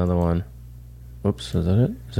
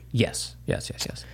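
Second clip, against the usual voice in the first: breathy whispered agreement; whispered yes; 10 dB quieter, slower than usual. Real, not acted. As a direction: whisper it under your breath. Quiet agreement during news talk.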